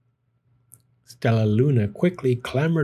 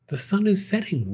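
warned; 8 semitones higher than usual. A narrator's reading. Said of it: "warned Flitter".

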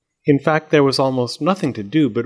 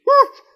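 short, very high yelp; muffled shriek; 7 dB louder than usual. A narrator's reading. Doll inside the egg alarmed by the axe, high shriek. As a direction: shriek briefly, loud and high. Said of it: "There was a muffled shriek".

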